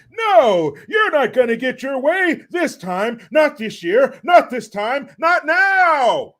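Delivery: loud, high mock politician yell; hammed-up shouting, climbing on the last words; yelling impression. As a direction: imitate a ranting speaker at full volume. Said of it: "he's yelling. So forgive me if I'm being loud".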